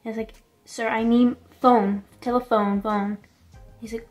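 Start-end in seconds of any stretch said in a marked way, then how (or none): 0.6-3.2 slow, spelled-out request; simplified for a foreigner. Retold or imitated. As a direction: re-enact making yourself understood, word by word. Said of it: "he didn't know what I was saying".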